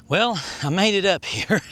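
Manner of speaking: ordinary talk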